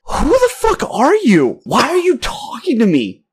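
high, drawn-out, baffled protest; exasperated disbelief. Retold or imitated. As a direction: replay your disbelief, stretching it way up high. Retells his exasperated comeback.

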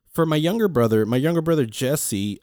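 ordinary talk, nothing marked

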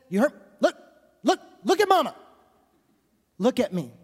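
high, loud call to a little one; mom calling toddler. Retold or imitated. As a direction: call out look at mama. Mother calling her toddler.

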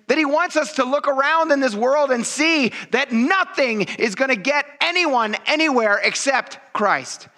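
high, building preacher's cry; full-force preaching. Acted. Pastor rousing the church to be on fire, preaching at full force. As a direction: preach it out, fired up.

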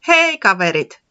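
calling friends from a distance; calling from afar. Acted. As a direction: hail them from far off. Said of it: "se huikkasi jo kaukaa".

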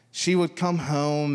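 usual speaking voice

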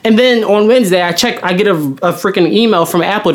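ordinary talk

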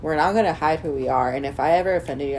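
ordinary talk, nothing marked

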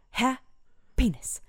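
breathy whisper, slow. Shown as a demonstration. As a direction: whisper it slowly, drawn out. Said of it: "practicing happiness".

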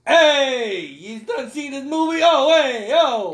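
put-on big drawn-out New York accent, calling out. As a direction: put on a brash cartoon New Yorker. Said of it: "talking with a New York accent".

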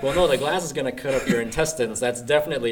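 loud, fast, laughing rebuttal; loud laughing retort. Retold or imitated. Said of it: "be like".

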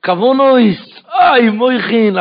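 loud, high, stretched-out words; fervent praying. Acted. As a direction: imitate lofty devotion, drawing each word out. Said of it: "התחילו להתפלל עם כוונות". (תפילה נלהבת, רמה ומשוכה)

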